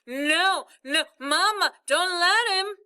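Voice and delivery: high, loud muffled begging; panicked plea. A narrator's reading. Stuck boy begging mother to stop father, panicked plea. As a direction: beg mother in rising panic. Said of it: "and mumbling".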